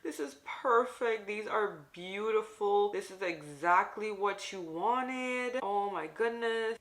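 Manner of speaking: mock a lash tech hyping up her client; sing-song fake delight over bad lashes; overhyping gush. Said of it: "she was overhyping the hell out of me".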